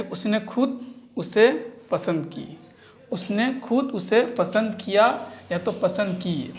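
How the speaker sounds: slow, careful reading of the sentence; slow dictation. Shown as a demonstration. Teacher to students, dictating slowly. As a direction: read it slowly so they can write. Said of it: "अब जो लिख सकते हैं".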